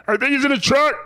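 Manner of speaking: high, loud mock bystander calling. Comedian voicing a passerby who finds Ray in a trunk, high and loud. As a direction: shout that someone's in the trunk.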